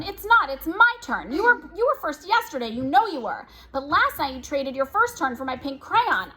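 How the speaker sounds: whiny sibling demanding her sock; squabbling child. Acted. Twins arguing on the stairs.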